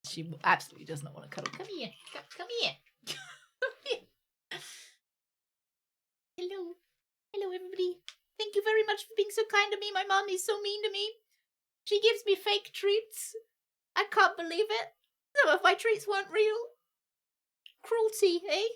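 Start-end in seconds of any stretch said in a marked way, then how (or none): 1.6-4.0 high, soft coaxing call; sweet soft call to the pet. Real, not acted. 6.4-18.7 high, put-upon pet voice; the pet complains about her mother, high and aggrieved; dog's voice. Acted. Speaks as the dog.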